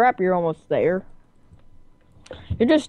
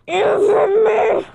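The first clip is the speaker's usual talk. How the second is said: shouting; 12 semitones higher, 5 dB louder, slower than usual. Real, not acted. Kids racing in game, yelling.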